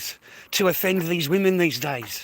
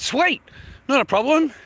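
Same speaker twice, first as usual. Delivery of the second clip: high, chirpy dismissal; mock breezy; 6 semitones higher than usual. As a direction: brush her off brightly, higher. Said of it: "I said".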